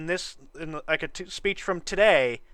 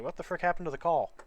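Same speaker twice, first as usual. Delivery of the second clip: ordinary talk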